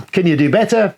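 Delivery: ordinary delivery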